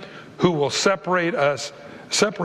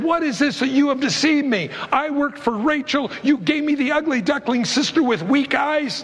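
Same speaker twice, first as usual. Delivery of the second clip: angry Jacob; 9 semitones higher than usual. Retold or imitated. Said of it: "and he said".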